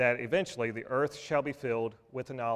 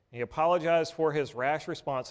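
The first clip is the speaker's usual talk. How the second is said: normal talking voice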